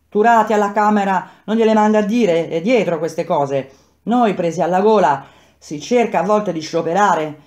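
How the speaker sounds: put-on a fiery old bricklayer lecturing his mates; heated worker's talk. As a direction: argue it out hotly, pressing the point. Quinto's heated talk to comrades.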